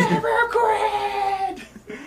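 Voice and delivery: extremely high wailing screech; mock scream. Rigby wailing back under Muscle Man's threat, hammed-up scream. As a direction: hold the last word in a long shriek. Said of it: "to make Rigby yell".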